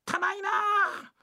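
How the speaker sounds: put-on high, slow, whiny like a child; childish whine. Husband complaining about the stable like a whining child. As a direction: whine it like a little kid. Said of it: "あんた子供か".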